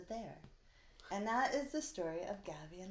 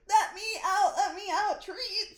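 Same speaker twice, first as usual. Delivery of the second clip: high, loud whining plea; excited dog; 13 semitones higher, 13 dB louder than usual. Pretend. The dog Sparty begging to be let out for treats, high and loud, whining. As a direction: beg like a frantic dog, laughing through it. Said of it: "Sparty was like".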